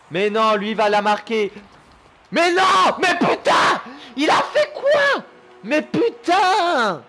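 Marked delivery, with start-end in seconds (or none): 2.3-7.0 high, loud shouts of disbelief; furious gamer outburst; outraged yelling. Real, not acted. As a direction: shout in real exasperation, dragging the last curse. Conceded goal in live match.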